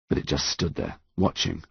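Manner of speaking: usual speaking voice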